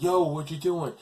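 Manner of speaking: retold indignant challenge; challenging vendor. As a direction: call him out, lightly acted. Street vendor confronts shirt taker.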